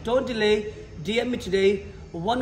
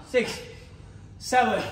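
ordinary talk, nothing marked

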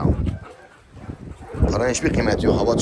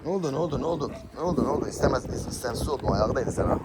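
comforting; 3 semitones higher, 7 dB quieter, faster than usual. Real, not acted. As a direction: coo gently to a startled pup. Comforting the startled puppy.

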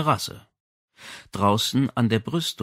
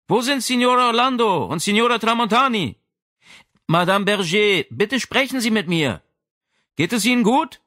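calling through a door; 11 semitones higher, 4 dB louder than usual. Acted.

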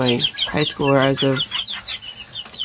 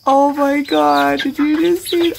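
slow, high astonished gasp of words; can't believe what she just saw; drawn-out high disbelief; amazed; 10 semitones higher, 3 dB louder, slower than usual. Real, not acted. Reacts to the hen pecking.

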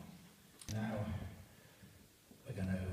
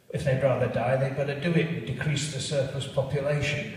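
cold Scrooge; 2 semitones higher, 7 dB louder than usual. Acted. Scrooge dismisses the poor coldly.

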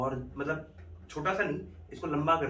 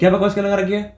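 faked angry shout; 7 semitones higher, 9 dB louder than usual. Faked quarrel.

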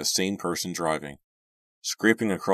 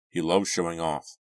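ordinary delivery, nothing marked

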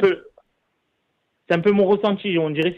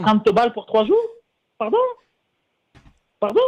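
shocked; 8 semitones higher than usual. Real, not acted. Indignant at low pay offer.

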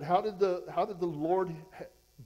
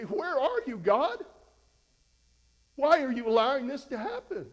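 loud, higher pleading cry at God; mock desperate cry; 6 semitones higher, 10 dB louder than usual. Believers whose life falls apart calling out loudly to God. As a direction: cry out to heaven in frustration.

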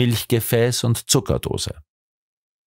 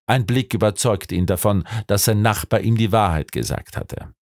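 ordinary delivery, nothing marked

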